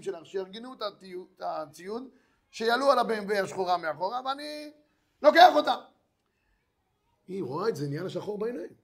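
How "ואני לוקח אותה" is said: threatening. Acted. Lender threatening to take the sons.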